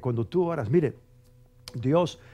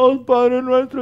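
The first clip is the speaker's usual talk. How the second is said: much higher and louder, wailing prayer; hammed-up tearful Our Father; mock tearful prayer; 9 semitones higher, 11 dB louder, slower than usual. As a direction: cry it out like a weeping believer. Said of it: "Aquel que le ora con lágrimas".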